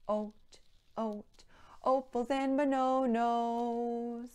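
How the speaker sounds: sung with oh in place of each vowel; O sound verse. Shown as a demonstration. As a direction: sing "oat, opals and bononos" with every vowel as long oh. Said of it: "Now with an O".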